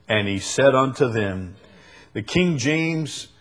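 ordinary talk

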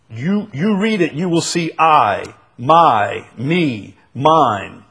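"my", "me" ve "mine" loudly punched, one by one; emphasis on I, my, me, mine. Real, not acted. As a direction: hammer "I", "my", "me", "mine" as personal words. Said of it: "It's very, very personal".

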